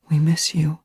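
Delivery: high, whispery murmur; breathed. A narrator's reading. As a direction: breathe it tenderly to someone unconscious. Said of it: "she breathed".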